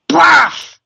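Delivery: loud high explosive paf; loud mock blast noise; imitated explosion bang. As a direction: burst out with a boom sound.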